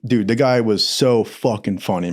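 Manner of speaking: ordinary talk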